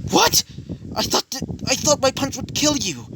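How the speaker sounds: high-pitched, stunned outcry; acted shocked scream. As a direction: blurt it high in disbelief. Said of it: "he would scream out".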